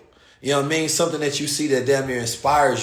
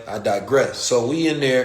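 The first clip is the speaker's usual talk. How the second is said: ordinary talk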